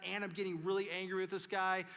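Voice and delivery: ordinary talk, nothing marked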